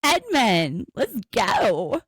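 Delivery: high, drawn-out cheer; excited cheering. Real, not acted. Cheering on her favourite couple.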